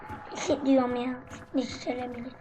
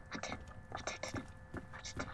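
urgent whisper; 25 dB quieter, much faster than usual. Real, not acted. Hiding, urging a teammate on.

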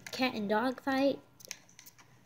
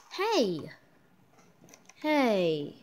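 ordinary talk, nothing marked

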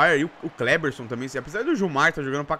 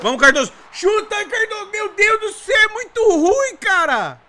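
exasperated shout; 13 semitones higher, 5 dB louder than usual. Real, not acted. Chance perdida, desespero.

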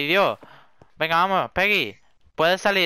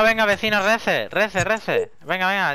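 ordinary talk, nothing marked

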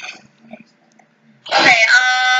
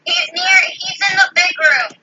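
normal talking voice

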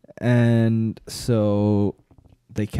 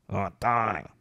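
low comic voice; 3 semitones lower, 6 dB quieter, slower than usual. Retold or imitated. Voicing a character in a story.

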